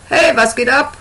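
put-on high cheeky teen call. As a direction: call out to the room. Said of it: "ruft sie den anderen zu".

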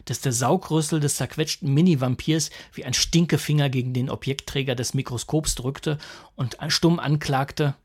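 plain reading voice

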